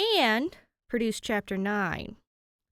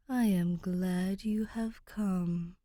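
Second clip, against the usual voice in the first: 1 ghostly amused voice; much slower than usual. Acted.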